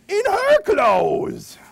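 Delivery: very high, slow squeal of surprise; high comic reveal. Acted. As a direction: hit the punchline high and drawn out. Dog dressed up reveal.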